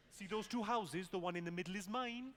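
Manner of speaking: puzzled Welsh question; mock Welsh bewilderment; confused Welsh accent. Shown as a demonstration. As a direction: sound baffled in a Welsh accent. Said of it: "you've just got to sound confused".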